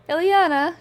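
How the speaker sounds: loud, high call of a name. Acted. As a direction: shout the name across the trees. Woman calls a name across forest.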